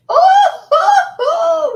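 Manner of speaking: very high, loud "oh" whoops; shocked whooping. Real, not acted. Shocked reaction to what is seen.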